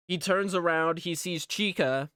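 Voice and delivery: normal talking voice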